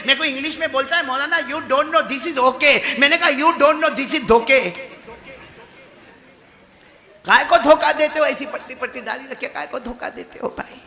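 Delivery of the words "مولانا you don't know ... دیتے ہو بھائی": high, loud, laughing mockery; mock retort. Preacher mocking the thin-beard man's English excuse. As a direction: tease him with a laugh.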